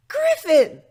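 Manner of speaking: very high, loud squeal of his name; excited shout. Acted. Fillies spotting their griffin friend, squealing excitedly. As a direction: call out his name with delight.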